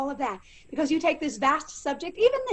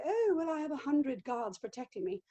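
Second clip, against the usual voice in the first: upper-class British accent with a refined HUNDRED; stagey upper-class British, swooping on the opening oh; Queen Elizabeth; 5 dB quieter than usual. Shown as a demonstration. Performer demonstrating the queen's line, upper-class British accent. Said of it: "if I was doing Queen Elizabeth and I'd say".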